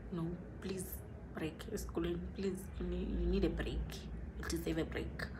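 whispered plea to herself; soft whispered self-talk. Real, not acted. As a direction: hush it like a private reminder. Telling herself to rest.

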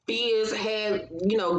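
ordinary talk, nothing marked

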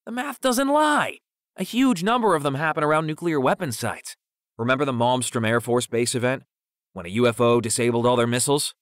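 high, loud conspiracy rant; fervent insistence. A narrator's reading. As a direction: insist excitedly, voice leaping up. Defends his conspiracy theory excitedly.

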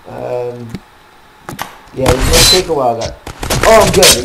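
normal talking voice

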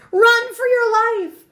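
very high, loud rabbit yell; acted storybook panic; panicked shout. A narrator's reading. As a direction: shout a drawn-out warning. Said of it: "shouted the rabbit".

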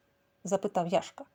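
ordinary delivery, nothing marked

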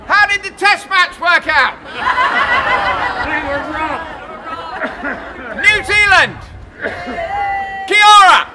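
loud proclaiming greetings; town crier. Acted. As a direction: greet each nation like a crier.

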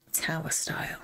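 soft whispered words; whispered aside. Real, not acted. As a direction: read the name under the breath. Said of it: "whispers the style name".

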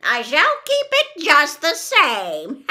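slow, loud, high witch voice; drawn-out, taunting witch; gloating witch. A narrator's reading. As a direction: gloat over the prize as a wicked witch. Witch gloats over stolen shoe.

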